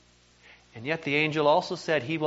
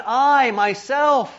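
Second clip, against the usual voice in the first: "I myself" drawn out, high and loud; slow, weighty stress on "I myself"; emphatic "I myself"; 6 semitones higher, 5 dB louder, much slower than usual. Retold or imitated. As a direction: stretch "I myself" slowly, with raised pitch and force. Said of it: "even more emphatic".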